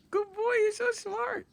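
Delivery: high, slow sing-song praise; doggy praise. Real, not acted. Praising dog.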